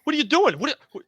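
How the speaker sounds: high, loud panicked protest; a bar owner shouting in alarm; startled owner yelling at intruders. Acted. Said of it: "the owner of the bar is like".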